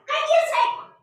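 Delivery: very high, loud frantic yell; retold shout. Aunt retelling how she yelled at her sister, high and loud. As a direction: shout it out in panic. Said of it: "yo decía".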